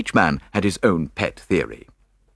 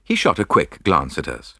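usual speaking voice

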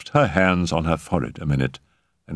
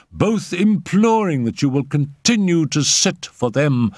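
raised pitch, keen and proud; eager enthusiasm; 8 semitones higher than usual. Acted. Raoul shows Simone the professors' letters, enthusiastic.

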